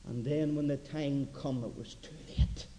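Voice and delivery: low, soft sermon voice sinking to a breath; hushed preaching. Acted. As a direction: let the warning fall quietly. Preacher's dramatic quiet close.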